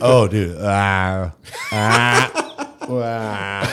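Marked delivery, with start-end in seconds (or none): 0.6-2.3 mock nauseous groans; imitated pre-vomit moan. Mimicking mommy about to spew, groaning in mock nausea. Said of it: "Mommy's about to spew". 2.8-3.7 softer mock groan; trailing nauseous "ah"; imitated pre-vomit moan. Mimicking mommy about to spew, soft mock groan. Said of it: "Mommy's about to spew".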